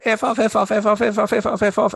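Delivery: angry caller voice. Retold or imitated. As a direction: imitate a caller ranting in a high voice. Scammer calls back swearing repeatedly.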